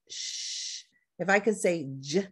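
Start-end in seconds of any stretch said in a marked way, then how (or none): 0.1-0.8 sh drawn out long and voiceless; stretched sh. Shown as a demonstration. Teacher stretching ch into sh. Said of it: "by stretching it out".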